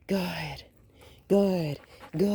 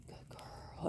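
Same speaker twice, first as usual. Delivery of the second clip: whispered praise to a nervous dog; soft whisper; 17 dB quieter than usual. Real, not acted. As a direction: breathe the praise without voice. Calming a startled dog.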